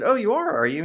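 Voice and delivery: high, teasing "oh, you are"; mock polite surprise. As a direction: play along with gentle irony. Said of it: "So I said".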